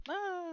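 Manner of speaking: sharp high startled cry; collision yelp. Acted. Boy colliding with his friend, short pained cry. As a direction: yelp as you slam into Trevor. Boys collide at front door.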